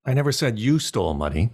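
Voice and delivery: "you" stressed, ending on a rise. Shown as a demonstration. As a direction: hit the word "you" in mid-sentence. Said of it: "emphasize "you".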